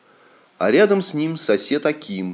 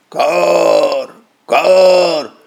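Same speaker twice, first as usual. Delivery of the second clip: crow caw; 2 semitones higher, 4 dB louder than usual. Acted. Narrator imitates crows.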